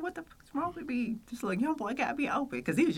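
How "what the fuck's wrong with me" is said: high distressed self. Retold or imitated. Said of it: "I was just like".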